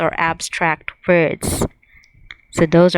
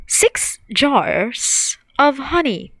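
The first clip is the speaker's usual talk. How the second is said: slow model phrase; 5 semitones higher, slower than usual. Shown as a demonstration. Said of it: "We can say".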